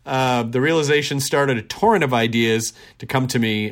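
ordinary talk

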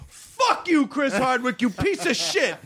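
a high, hammed-up curse; mock angry fan. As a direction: imitate the spurned fan lashing out.

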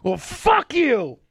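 high-pitched mock screaming match; retold screaming. Host re-enacting two friends fighting in the car, screaming. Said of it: "screaming at each other".

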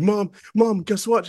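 high, loud, bursting with news; retold excited. Actor calling mom about the role, bursting with excitement. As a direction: re-enact phoning home with huge news.

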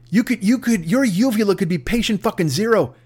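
ordinary talk, nothing marked

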